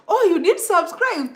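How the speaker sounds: ordinary delivery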